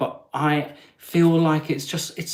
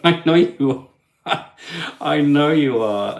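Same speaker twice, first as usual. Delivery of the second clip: laughing; 2 semitones higher, 4 dB louder than usual. Real, not acted. Laughing at his own joke.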